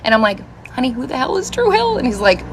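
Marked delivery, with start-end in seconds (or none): 0.7-2.0 high-pitched puzzled question; clueless questioning. Retold or imitated. Wife asking husband about a band, clueless questioning. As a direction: ask it high, totally clueless.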